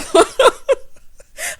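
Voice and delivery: high, loud burst of laughter. Real, not acted. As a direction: crack up after your own joke. Cracks up after mock singing.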